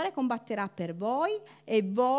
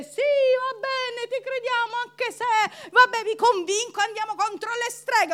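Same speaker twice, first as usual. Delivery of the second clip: grudging mock agreement; 9 semitones higher, 3 dB louder than usual. Church members humoring the pastor, high reluctant mock agreement. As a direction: play the unconvinced believer. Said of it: "Voi dite".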